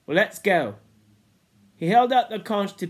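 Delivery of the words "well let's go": ordinary delivery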